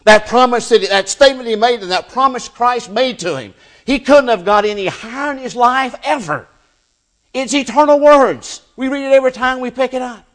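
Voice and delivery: high, loud, impassioned preaching; full-force preaching. Real, not acted. Preacher exalting the promise Christ made Peter, full-force preaching. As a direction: lift pitch and volume and keep them up.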